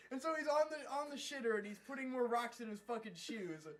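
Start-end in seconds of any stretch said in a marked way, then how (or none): none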